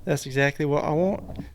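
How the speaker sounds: put-on Southern accent drifting into English; attempted Southern accent. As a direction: try a Southern accent and miss. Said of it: "Let me try that again".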